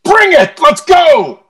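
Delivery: yelling high and loud, pumped up; hype shout. Real, not acted. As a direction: get the crowd going, full volume. Hyping up before the wheel.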